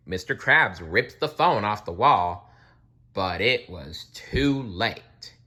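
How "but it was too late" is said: ordinary delivery, nothing marked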